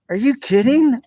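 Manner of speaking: imitating a let-down child's disbelief; retold disbelief. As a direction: replay the kid's shock at an empty stocking. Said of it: "I just was like".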